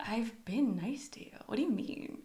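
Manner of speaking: half-whispered mock defence; hushed protest. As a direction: drop to a near whisper. Acting out a wounded reply.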